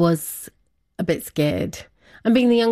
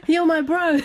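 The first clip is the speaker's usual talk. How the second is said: laughing through a high quoted line; laughing quote; 11 semitones higher than usual. Retold or imitated. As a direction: laugh while quoting him fondly. Retelling brother's words, laughing.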